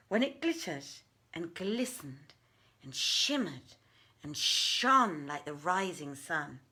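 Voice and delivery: ordinary delivery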